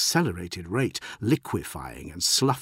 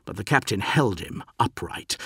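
plain reading voice

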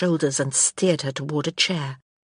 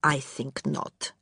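softer, slow, very low reply; 3 semitones lower, 7 dB quieter, slower than usual. A narrator's reading. Maid answering the empress's lady, much softer and slow. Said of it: "she said very low".

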